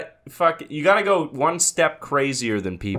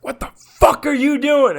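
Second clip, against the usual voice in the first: much higher, louder mock shout; retold yell; 6 dB louder than usual. Narrator confronting bleach-dumping upstairs neighbor, yelling. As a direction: blast him at the door. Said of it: "and I was like".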